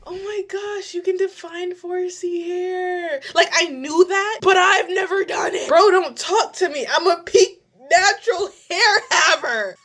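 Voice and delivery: giddy disbelief at your own success; excited. Real, not acted. Bragging to viewers about her defined hair, giddy excitement.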